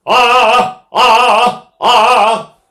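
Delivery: loud high punched ahs; accented ahs. Shown as a demonstration. Voice teacher demonstrates accents, loud high punched ahs. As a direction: punch each ah from the belly. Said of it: "And somebody go".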